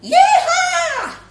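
very high, loud cowboy whoop; wild yee-haw; whooping shout. Acted. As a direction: let rip like a rodeo rider. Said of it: "The mum on the tortoise bouncer shouted".

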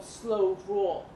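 plain reading voice

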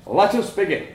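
a father preaching tradition to his family; stern farmer father lecturing. A narrator's reading.

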